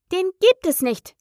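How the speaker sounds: high-pitched indignant objection; very annoyed. A narrator's reading. Alice rejecting the treacle well, very annoyed. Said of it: "fing Alice sehr ärgerlich an".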